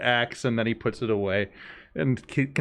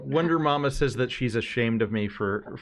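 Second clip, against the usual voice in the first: ordinary talk, nothing marked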